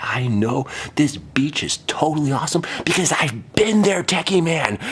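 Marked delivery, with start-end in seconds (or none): 2.8-4.8 gloating. Acted.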